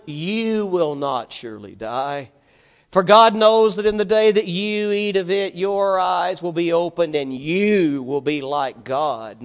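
drawn-out, weighty stress on each "You". Acted. Preacher reading the serpent's words to Eve, stressing every "you". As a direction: lean slowly and hard on the word "you". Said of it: "notice the emphasis on the word".

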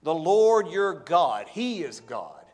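emphatic reread. Real, not acted. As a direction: press each word, "Lord" leaned on. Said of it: "The last part of the verse says".

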